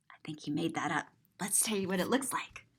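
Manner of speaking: conspiratorial whisper; hushed aside to a child. Acted. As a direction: lean in and whisper. Whispered aside about made-up word.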